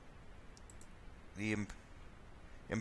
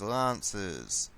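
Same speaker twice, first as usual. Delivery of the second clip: normal talking voice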